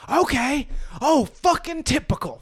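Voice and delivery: high-pitched sarcastic outburst; acted berating. Boss loses it at an aide over a fork, acted high berating. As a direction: berate an underling in mock exasperation. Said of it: "So I'm acting out the berating".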